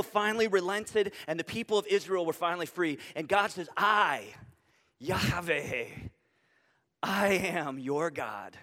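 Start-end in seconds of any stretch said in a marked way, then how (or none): 3.7-8.6 soft, slow, low I, Yahweh, I am your God; intimate and personal. Shown as a demonstration. God speaking tenderly to his freed people, soft and slow. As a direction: speak it gently, close and personal. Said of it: "It's personal".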